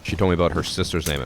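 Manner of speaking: ordinary delivery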